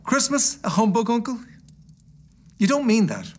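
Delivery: high-pitched, good-natured disbelief; protesting nephew. Acted. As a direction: plead with a grumpy uncle, pitched well up. Nephew protests to his uncle.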